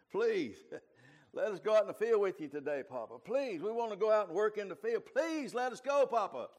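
put-on high, loud pleading kid voice with laughter; children begging Papa to take them to the field, high and loud. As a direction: whine it up like kids pestering grandpa. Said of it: "And so they started begging".

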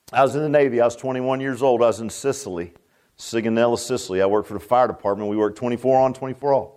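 normal talking voice

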